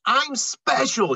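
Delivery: put-on proud girl. Girl's proud quote in story.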